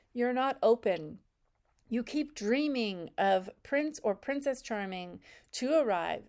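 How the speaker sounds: normal talking voice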